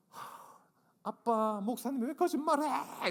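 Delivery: put-on high childish cry of protest; imitating shouting daughter. Angry daughter after falling off the bike, high childish shout. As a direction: squeal it like an indignant little girl. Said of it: "이렇게 외쳤습니다".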